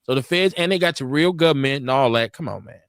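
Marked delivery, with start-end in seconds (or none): none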